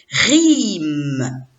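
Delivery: rime drawn out, long I into a held M; syllabe en entier. Shown as a demonstration. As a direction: stretch rime as one blended syllable. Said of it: "Je lis la syllabe en entier". (rime étiré, sons liés)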